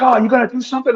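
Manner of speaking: high-pitched mocking imitation of a challenger; taunting challenge. Stranger squaring up to him in the street: high mocking taunt. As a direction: imitate a guy daring him to fight. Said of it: "He's like".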